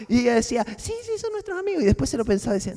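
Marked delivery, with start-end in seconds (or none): 0.8-1.8 put-on higher, a bit faster small child; little girl voice. Girl agreeing about the pastor, higher little girl voice. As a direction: lightly play the eager little girl. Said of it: "se convirtió en una niña pequeña".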